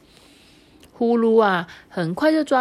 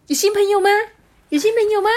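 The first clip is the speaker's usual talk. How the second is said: much higher, louder bouncy question; eager bunny; 7 dB louder, much faster than usual. Acted. The rabbit hopping up to the group, high and excited. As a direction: burst in excited about new friends.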